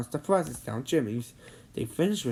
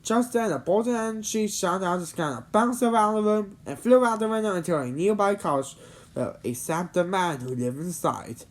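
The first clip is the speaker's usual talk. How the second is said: usual speaking voice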